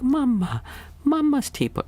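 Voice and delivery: put-on very high, wailing toddler voice; crying toddler. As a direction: voice a little boy upset about his mum's teapot. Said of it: "my son started crying".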